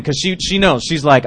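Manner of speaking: normal talking voice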